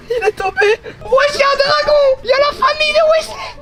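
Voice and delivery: high, astonished cries; shocked excitement. Real, not acted. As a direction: freak out at the huge lizards. Shocked at giant lizards.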